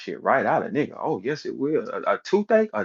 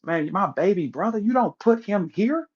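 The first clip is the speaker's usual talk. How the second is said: lightly voiced, higher protective brother; a hint of a caring big brother; 10 semitones higher than usual. Lightly voiced big brother telling his baby brother to go the other way, higher. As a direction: steer the little brother away from danger. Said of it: "He sacrifices his little brother".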